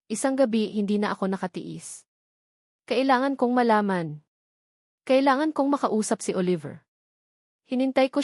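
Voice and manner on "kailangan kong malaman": usual speaking voice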